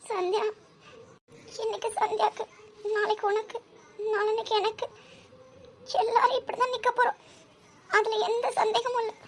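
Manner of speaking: high, distressed voice; high-pitched and upset; tearful lament. Acted. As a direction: lament it, close to tears. Lamenting their shared fate.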